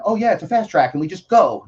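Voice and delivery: breezy mock version. Shown as a demonstration. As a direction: say it like it's no big deal. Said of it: "I don't mean".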